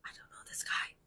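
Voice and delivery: unvoiced whispered aside. Woman whispering to herself about a stranger, wary. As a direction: whisper it to yourself, uneasy. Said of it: "And I'm like".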